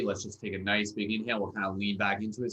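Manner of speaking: ordinary talk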